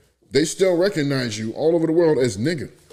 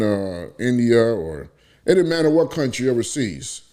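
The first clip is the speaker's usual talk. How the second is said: ordinary delivery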